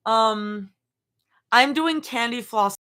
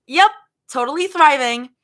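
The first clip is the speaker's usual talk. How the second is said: loud, high, too-eager reassurance; pitched up, forced cheer; overbright cover-up; 6 semitones higher, 8 dB louder than usual. Acted. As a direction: insist you're fine far too keenly. Character claims she is fine.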